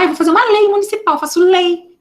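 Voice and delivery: mock eager lawmaker. Imitating someone planning a law.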